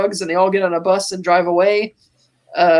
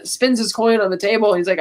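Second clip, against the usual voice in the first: normal talking voice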